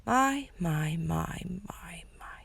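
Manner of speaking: a drawn-out mocking tease, dropping into a whisper; sly villain voice. A narrator's reading. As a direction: creep up behind them with a sneaky singsong. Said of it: "said a voice from behind them".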